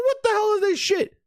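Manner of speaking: high-pitched mock outrage; outraged Herman. Herman confronting the art room team, high indignant outburst. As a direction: act appalled, voice jumping up.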